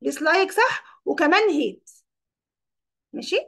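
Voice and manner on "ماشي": usual speaking voice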